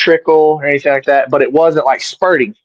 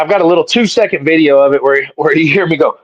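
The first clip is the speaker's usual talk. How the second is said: ordinary delivery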